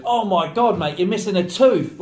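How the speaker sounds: loud, high teasing exclamation; mock outburst. Pele teasing a new teammate about his tooth, loud and high. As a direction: shout it out, ribbing a new lad. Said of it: "Pele goes".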